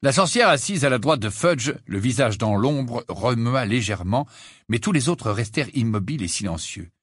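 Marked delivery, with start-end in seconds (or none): none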